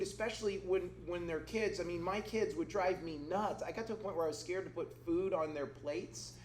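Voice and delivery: ordinary delivery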